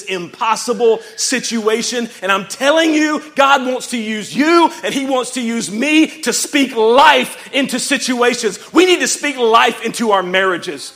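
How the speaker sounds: high, very loud rallying preacher; full-force preaching. Real, not acted. Preacher urging the church to speak life, full-force shouting. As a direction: drive each line home with a shout.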